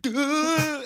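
imitating sobbing kids. Imitating crying kids after test.

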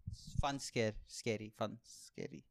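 ordinary talk, nothing marked

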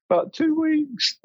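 very high, mock tearful answer; imitating a tearful wife. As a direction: play the wife sobbing her answer, lightly. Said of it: "give me the whole crying".

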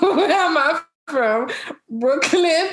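high-pitched puzzled echo with a laugh; amused confusion. Retold or imitated. Recalling being asked where she is from, laughingly confused. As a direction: laugh through a baffled answer. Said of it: "I'm always confused".

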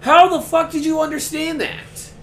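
high, loud disbelief; baffled and loud; incredulous. Retold or imitated. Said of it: "And you're like".

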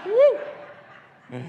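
high, drawn-out "woo"; playful whoop. Pretend. Preacher joking about his lucky wife, playful high whoop. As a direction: let out a high, showy whoop.

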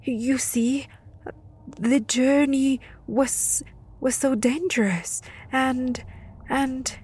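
put-on boy stammers before the death gods; frightened whisper. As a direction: stammer it out, terrified. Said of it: "Yautl finally whispered".

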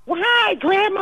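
lightly imitated grandchild wheedling; sweet grandchild. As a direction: coax grandma like a sweet little kid. Grandchild sweet-talking on phone.

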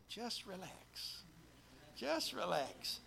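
slow, soft, soothing "Just relax"; gently calm your neighbour, slowly; calm reassurance. Shown as a demonstration. To the person beside you, soft slow reassurance. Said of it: "Turn to the person beside you and just say".

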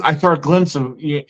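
ordinary delivery

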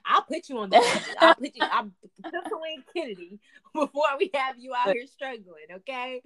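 teasing friend, laughing through it; laughing mock reassurance. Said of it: "I was like".

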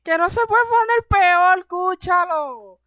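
high, loud mocking cosmic voice; voice of fate. Fate answering the comedian from space, high loud taunting. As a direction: taunt him from the sky. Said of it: "el destino como que".

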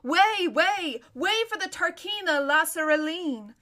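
loud, high crier's call; crier's shout. Acted. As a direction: call out to clear the road. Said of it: "was heard shouting out".

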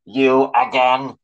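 loud, slow angry bark. A narrator's reading. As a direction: snap it out like an enraged robot. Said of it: "it barked to the Tin Man".

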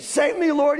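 high, loud cry to the Lord; loud desperate shout for help; shouted plea. A narrator's reading. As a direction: cry out as if drowning. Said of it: "he shouted".

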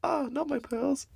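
very high, mock-horrified lady voice; shocked wife. Wife being robbed of her pearls, in a squeaky mock-horrified voice.

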